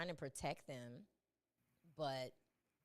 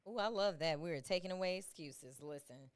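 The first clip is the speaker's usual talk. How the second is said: ordinary talk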